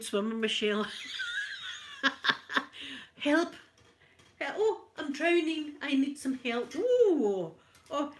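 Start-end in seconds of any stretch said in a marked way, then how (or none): 0.9-3.2 high shrieking laugh. Real, not acted. Laughing at own joke. 3.2-7.5 playful pretend drowning plea; hammed-up calls for help; mock cries for help.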